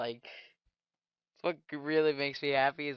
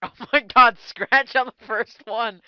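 excited shout; 6 semitones higher, 9 dB louder than usual. Real, not acted. Cheering for the opponent's mistake.

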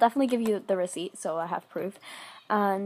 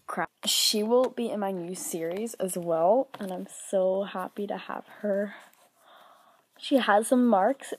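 normal talking voice

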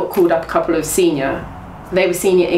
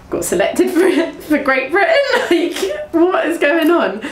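high-pitched, laughing disbelief; can't believe the news, giggling it out; retold disbelief; 11 semitones higher than usual. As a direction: laugh through it, pitched up in amazement. Retells stunned reaction to selection.

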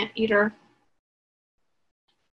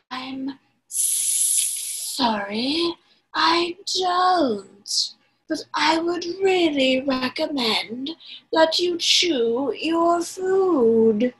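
slow snake voice; 4 semitones higher, much slower than usual. Acted. Anaconda voiced.

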